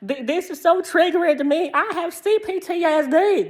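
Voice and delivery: a high, hammed-up whine mocking a triggered friend. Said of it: "She's so fucking triggered".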